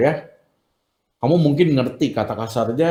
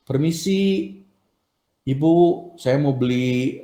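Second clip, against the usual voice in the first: a lightly voiced, politely raised "Permisi"; polite shop request. Shown as a demonstration. Teacher showing students how to ask at a shop, lightly polite. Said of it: "itu ya, kalau kamu ke warung misalnya".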